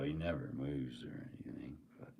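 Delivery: hushed joking aside; soft conspiratorial whisper. Real, not acted. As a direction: whisper it as a secret. Whispered joke about knight statue.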